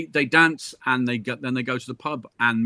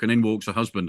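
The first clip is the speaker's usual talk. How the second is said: ordinary delivery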